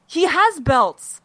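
"has" stretched slowly and hit; "He has belts" slowly, leaning on "has"; stress on has. Real, not acted. Emphatic correction.

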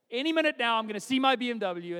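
high, loud burst of pretend certainty; hammed-up excited believer; mock eager anticipation. Believer visualizing the coming BMW, mock excitement. Mocked believer awaiting imagined car.

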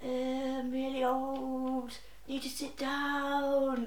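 slow, put-on frail old woman; slow mocking old lady voice. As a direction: drag it out like a pitiful old lady. Mimics old lady's excuse.

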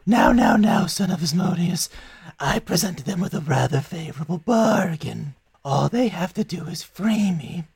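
a silky, bargaining fiend, lightly voiced; a chained devil bargaining with the party, silky; smooth devil. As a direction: sell the deal like a charming devil.

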